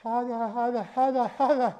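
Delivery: panting around a scalding mouthful; high huffing through hot food; mouth full of hot food. Real, not acted. Whole hot bite in mouth.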